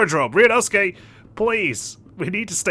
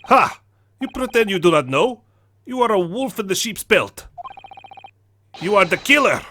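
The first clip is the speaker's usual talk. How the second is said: accusing Russian sailor; 3 semitones higher than usual. Acted. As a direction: pounce on the suspect in a Russian accent.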